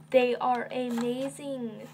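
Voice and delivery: ordinary talk, nothing marked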